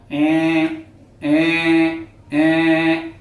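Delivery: "ए" said low through the nose, drawn out; nasal ए like a bleating goat; nasal ए demo. Shown as a demonstration. Doctor demoing egophony to students, nasal bleat. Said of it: "वो nasal ए जैसा pronounce करेगा".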